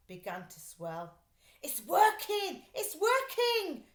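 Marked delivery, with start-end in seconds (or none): 1.6-3.9 high, loud and slow, thrilled; excited shout. Acted. Arthur shouting as the pump works, loud and high. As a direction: cry out in triumph. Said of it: "shouted Arthur".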